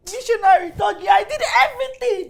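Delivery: loud, high-pitched aggrieved complaint; wronged and worked up; indignant outburst. Acted. Jilted daughter venting to her mother.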